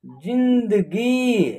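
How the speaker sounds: slow, soft word read for a class; very slow reading of जिंदगी; slow syllabified. Shown as a demonstration. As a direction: stretch the word out for learners. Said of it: "आ, ज में जो है छोटी ई की मात्रा है तो जी".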